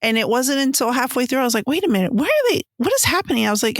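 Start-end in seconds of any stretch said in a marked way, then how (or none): 1.7-3.5 higher-pitched, incredulous questioning; acted-out confusion, pitch jumping up; retold bafflement. Reader recalling her confusion halfway through the book, baffled.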